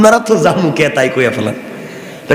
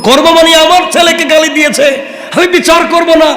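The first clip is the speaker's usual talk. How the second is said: indignant shout; 11 semitones higher than usual. Acted. Umar shouting at Ali over his insulted son, angry. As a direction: demand justice at the top of the voice. Umar angrily demands judgment.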